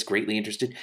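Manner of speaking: ordinary delivery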